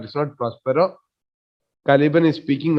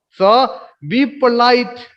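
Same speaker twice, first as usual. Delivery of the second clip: slow emphatic; 6 semitones higher, 3 dB louder, slower than usual. Shown as a demonstration. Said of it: "teacher repeats command".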